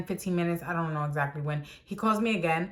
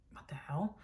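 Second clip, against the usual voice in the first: shocked whisper under her breath; 5 semitones lower, 15 dB quieter than usual. Retold or imitated. Walking into the crowded store, whispered shock. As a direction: breathe it out in disbelief.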